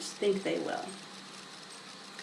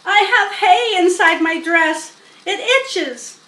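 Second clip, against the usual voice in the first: higher, louder complaining call; 7 semitones higher, 7 dB louder than usual. A narrator's reading. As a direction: call out over the noise. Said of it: "called Fern".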